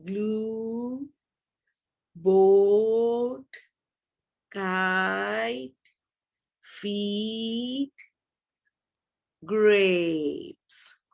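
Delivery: each word stretched slowly with a long vowel; long vowels drawn out. Shown as a demonstration. Teacher showing the class how long vowels sound. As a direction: drag out every long vowel. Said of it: "the long goes like this".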